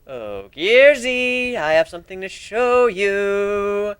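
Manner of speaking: high, very slow sing-song tease; creepy teasing. Acted. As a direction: drag it out to spook him. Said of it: "time I freaked them out".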